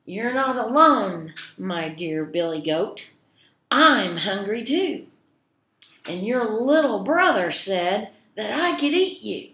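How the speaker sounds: loud, emphatic troll voice; forceful troll, stressing key words; hungry troll. Acted. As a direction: loudly press your claim on the goat. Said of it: "And the troll said".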